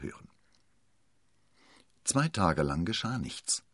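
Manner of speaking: usual speaking voice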